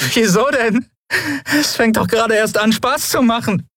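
high, strained giddy taunt; high hysterical defiance; touch of hysteria. A narrator's reading. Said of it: "mehr als einen Anflug von Hysterie".

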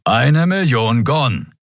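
grumpy, stretched-out reminder; growling. Acted. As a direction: growl the price, stretching every word. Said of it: "knurrte Keru".

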